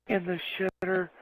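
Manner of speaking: high, disbelieving question; can't believe what he hears; incredulous question. Retold or imitated. Asking Jack about the skunk, high and disbelieving. His incredulous retold reply.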